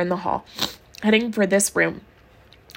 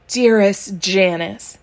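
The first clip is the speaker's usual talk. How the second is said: slow dictation; much slower than usual. Shown as a demonstration. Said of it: "don't worry about punctuation".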